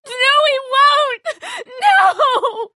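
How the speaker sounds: high, slow sobbing scream; crying scream. Acted. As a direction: scream it through tears. Sister about to be shot.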